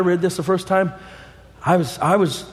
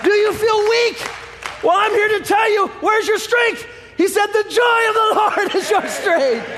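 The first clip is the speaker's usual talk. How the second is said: preaching at full force. Acted. Preacher exhorts the congregation.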